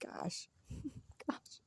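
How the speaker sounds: a sigh, then a whispered "Gosh". Real, not acted. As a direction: breathe out "Gosh" under the breath. Sighing dismay after rant.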